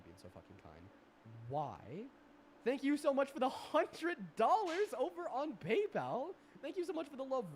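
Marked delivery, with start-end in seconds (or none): 2.7-7.4 high, loud and slow gushing; hyped shout-out. Real, not acted. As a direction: yell out thanks, thrilled. Thanks hundred-dollar donor.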